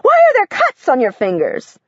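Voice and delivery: high, tense and worried; a teacher alarmed at the sight of cut fingers; a trembling high question; high shaky voice. A narrator's reading. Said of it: "Her voice is high and shaky".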